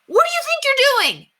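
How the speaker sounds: put-on high, loud boyish demand; a boy snapping at his little sister; forceful demand. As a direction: stop her in her tracks. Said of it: "spoke more forcefully".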